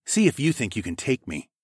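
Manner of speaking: usual speaking voice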